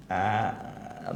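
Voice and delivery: ordinary talk, nothing marked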